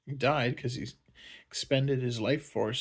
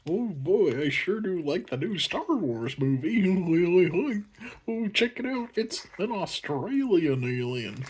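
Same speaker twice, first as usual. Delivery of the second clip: lightly voiced dazed fan, higher; mock stoned moviegoer; 6 semitones higher than usual. Mocking himself stoned at Star Wars, a higher goofy voice. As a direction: gush like you're high at the movies. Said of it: "be all like".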